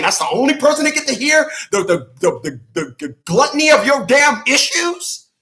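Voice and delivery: fast, high and loud, getting carried away; worked-up rant. Real, not acted. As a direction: let the rant run away with you. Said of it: "I went left for a second".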